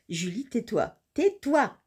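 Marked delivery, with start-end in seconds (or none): 1.1-1.8 high, emphatic order to oneself; insistent self-scolding. Real, not acted. Julie telling herself to stop joking, insistent. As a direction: cut yourself off, higher and firmer.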